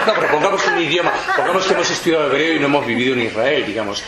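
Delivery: words bubbling with a real laugh. Real, not acted. Laughing after the joke.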